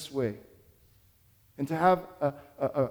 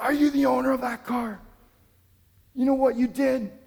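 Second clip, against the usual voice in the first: much higher, mock angry demand; retold yelling; 9 semitones higher than usual. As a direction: accuse like an angry stranger. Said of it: "he just started yelling at me".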